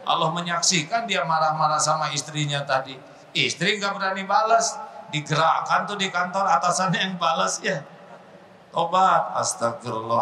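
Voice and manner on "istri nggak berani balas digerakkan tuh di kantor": ordinary delivery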